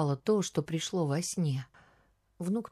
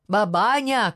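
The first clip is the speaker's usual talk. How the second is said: loud, higher-pitched cry to wake her; shouted call; 4 semitones higher, 6 dB louder than usual. A narrator's reading. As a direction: shout it to rouse a sleeper. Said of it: "крикнул он".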